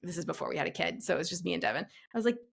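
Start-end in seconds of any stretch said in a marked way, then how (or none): none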